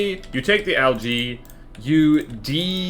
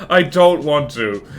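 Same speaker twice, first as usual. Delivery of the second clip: loud, drawn-out protest; protesting refusal; 7 dB louder, slower than usual. Real, not acted. Streamer refuses to change UI colors, protesting loudly. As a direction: dig your heels in, slow and loud. Refuses viewer's request, first take.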